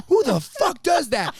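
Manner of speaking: mock outrage, laughing at her; incredulous. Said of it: "He's like".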